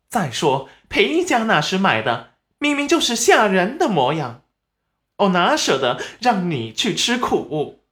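high, insistent excuse-making; a mother hotly justifying herself; flustered self-defence. Acted. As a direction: protest your innocence to your daughter. Acted mother defends herself to daughter.

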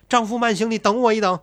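a high, loud cry after her husband; calling out. Acted. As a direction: call out to stop him leaving. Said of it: "叫道". (高声急呼“丈夫慢行”)

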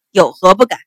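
loud, curt officer's challenge; defiant and forceful; defiant retort. A narrator's reading. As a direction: throw it back at the maid, loudly. Officer defies the maid, loud retort.

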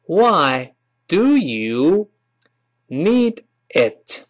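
each word slow and separate; word by word, WHY, DO YOU, NEED IT with gaps; slow careful reading. Shown as a demonstration. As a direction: spell it out slowly for learners. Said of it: "但是读快了之后有些发音的变化".

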